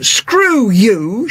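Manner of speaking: mild sneering retort; contemptuous. Acted. Agatha whipping round at the diner, contemptuous. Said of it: "she said contemptuously".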